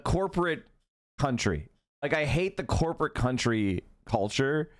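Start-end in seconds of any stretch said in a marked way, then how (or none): none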